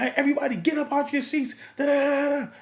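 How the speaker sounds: high-pitched, quick MC call; announcing the couple. Retold or imitated. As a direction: imitate a DJ bringing the room to its feet. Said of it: "I shout them out".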